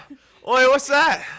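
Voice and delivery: loud, high startled challenge; startled callout. Retold or imitated. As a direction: call out at a sudden intruder, acted. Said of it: "I was like".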